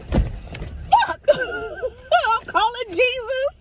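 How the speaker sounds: very high, laughing squeal; retold high-pitched panic; comic panic. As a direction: squeal in mock terror. Acts out panic at reaching shadow.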